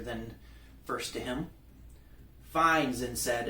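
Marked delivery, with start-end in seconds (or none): 2.4-2.9 a loud, higher grudging "Fine"; agitated. A narrator's reading. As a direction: give in but let the annoyance show. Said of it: "agitated but willing to acquiesce".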